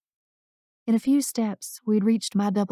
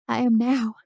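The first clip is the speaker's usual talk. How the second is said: choked out; 2 semitones higher than usual. A narrator's reading. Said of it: "I choked out".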